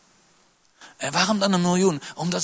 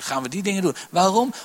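ordinary talk, nothing marked

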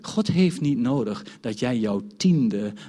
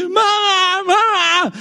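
high, loud wailing for mama; imitating wailing child; 10 dB louder, much slower than usual. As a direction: wail it like a hysterical toddler. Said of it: "zag ik kinderen huilen, hysterisch gewoon".

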